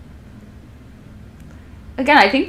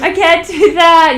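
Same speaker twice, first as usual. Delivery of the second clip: startled refusal; 11 semitones higher, 8 dB louder than usual. Retold or imitated. Startled refusal in acted inner voice.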